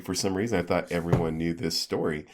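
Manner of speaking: normal talking voice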